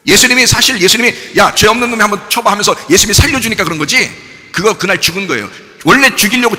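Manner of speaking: loud, high-pitched sermon delivery; full-force preaching. Acted. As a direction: drive the story home from the pulpit.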